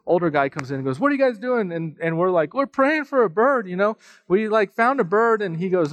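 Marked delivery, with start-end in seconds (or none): 1.0-1.7 put-on high questioning old man voice; mock older guy. Older neighbor to praying kids, lightly voiced puzzled. 2.5-5.4 put-on high eager child voice; kid voice. Kids tell the old man, high eager voice. Kids explain they pray for bird.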